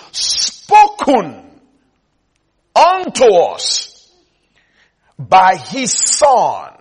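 builds from steady repeats to a loud slow climax; fiery preacher building to a peak; preaching crescendo. Acted. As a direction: thunder the verse home, stretching the last words. Preaching the verse at full force.

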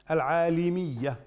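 العالمية with a short i after the ل; kasra on the lam. Shown as a demonstration. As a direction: say العالمية as al-ʿālimiyya, "li" in the middle. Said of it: "بكسر اللام". (العالمية بكسر اللام)

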